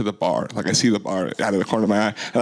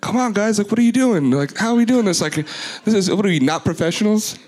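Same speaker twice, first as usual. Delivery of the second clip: much higher, indignant mock complaint; feigned anger; 11 semitones higher than usual. DJ faking outrage at the crew over a dead mic, mock angry. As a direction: put on a mad act, pitched way up. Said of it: "then just act really mad".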